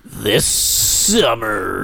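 slow, low movie trailer announcer. Pretend. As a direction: hype it like a trailer narrator. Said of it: "I'm turning into a movie trailer guy".

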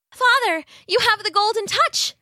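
delighted little daughter exclaiming; excited young girl. A narrator's reading. Daughter amazed at golden touch.

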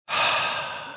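long weary exhale; heavy sigh. Real, not acted. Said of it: "That was a heavy sigh".

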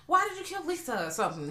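mock high panicked questioning. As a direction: ask it like a panicked victim. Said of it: "The clown was like".

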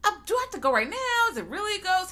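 high, whiny reluctant grumble; Vickman. Retold or imitated. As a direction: whine it like a reluctant skeptic. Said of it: "Vickman is like".

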